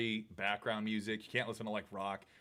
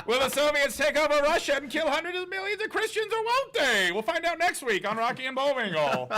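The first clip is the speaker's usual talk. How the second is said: much higher, louder mock Indian accent, laughing; terrible Indian accent; 9 dB louder than usual. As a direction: ham up an Indian accent, cracking up. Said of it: "That was a terrible Indian accent".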